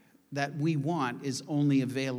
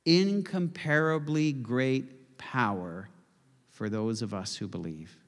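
slow, weighty repeat; slower than usual. Shown as a demonstration. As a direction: draw out incomparably great power. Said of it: "hang out there for a minute".

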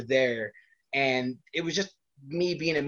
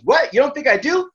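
high, loud challenge; indignant mom; 12 semitones higher, 8 dB louder than usual. Retold or imitated. Mom answering her doubting son, high, loud and affronted. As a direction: imitate mom's incredulous comeback.

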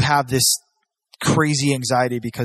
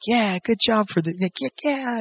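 cheering; 9 semitones higher than usual. Retold or imitated. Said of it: "you being like".

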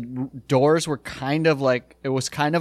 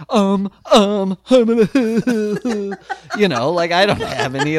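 higher, giggling through a fumbled excuse; nervous laughing stammer; 5 semitones higher, 4 dB louder, much slower than usual. Retold or imitated. Caught in a stranger's apartment, stammering to the jock with a nervous laugh. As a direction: laugh out a guilty non-answer.

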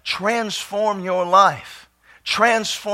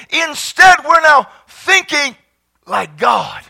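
loud, driving preacher's shout; full-force preaching; 6 semitones higher, 7 dB louder than usual. Acted. Pastor preaching to the congregation, full-force shouting. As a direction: preach it to the rafters, raised high and loud.

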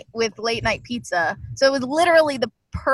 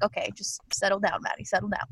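quiet self-talk; 3 semitones lower, 8 dB quieter than usual. Retold or imitated. Calming herself inner voice.

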